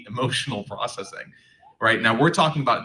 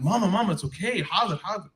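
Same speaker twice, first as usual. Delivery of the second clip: pleading son; 9 semitones higher than usual. Retold or imitated. Son calming his mother.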